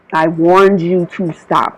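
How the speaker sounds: ordinary talk, nothing marked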